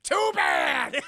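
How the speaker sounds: loud, high, drawn-out mock yell; shouted retort. Man throwing people out of the restroom, shouted. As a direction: yell it back with no sympathy.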